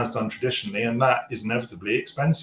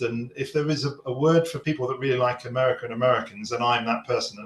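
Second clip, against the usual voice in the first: ordinary talk, nothing marked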